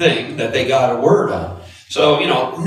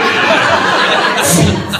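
real laugh; 13 semitones higher, 7 dB louder than usual. Real, not acted. Laughing at his own story.

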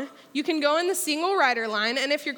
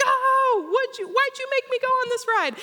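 imitating a scared kid; 11 semitones higher than usual. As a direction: play the terrified boy on the coaster. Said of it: "He's, like, screaming".

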